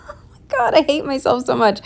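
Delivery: high, fast laughing oh my God; laughing exasperation. Real, not acted. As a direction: laugh at yourself in disbelief. Laughing at her own mistake.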